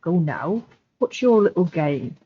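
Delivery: put-on Sam in a rural Devonshire accent. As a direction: Devonshire voice, quiet and suspicious. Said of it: "in a Devonshire accent".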